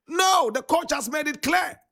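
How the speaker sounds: high, emphatic call to the crowd; rising emphatic no, pitched high; emphatic proclaiming. Real, not acted. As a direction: declare it like a preacher. Preacher proclaims the coach's stance.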